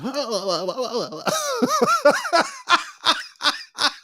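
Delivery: long high wheezing laugh; crying laugh. Real, not acted. Cracking up at the story. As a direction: laugh until it hurts.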